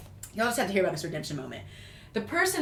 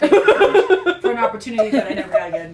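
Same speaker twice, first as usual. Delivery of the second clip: laughing thanks; 10 dB louder than usual. Real, not acted.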